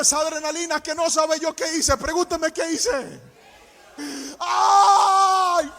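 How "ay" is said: high, loud scream that breaks into laughter; laughing scream. Retold or imitated. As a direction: scream like the coaster just dropped. His scream on the drop.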